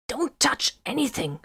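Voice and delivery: high-pitched, tense comm voice; tense high voice over comm; urgent officer's warning. A narrator's reading. Solomon warns over comm.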